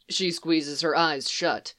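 ordinary delivery

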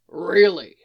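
loud, doubting question; skeptical. A narrator's reading. Firebird challenging Barry's claim, loudly skeptical. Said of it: "voice dripping with skepticism".

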